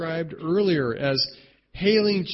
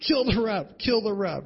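mock scream; 6 semitones higher than usual. Fan at a sporting event after a bad call, mock scream. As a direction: imitate an outraged fan's cry, held back in volume. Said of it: "You scream at the ref".

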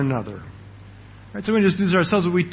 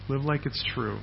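"Live like it's true" repeated much softer, dropped down; 3 semitones lower, 9 dB quieter than usual. Shown as a demonstration. Preacher to his church, pressing the point in a softer voice. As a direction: say "Live like it's true" again, quietly, letting it sink in.

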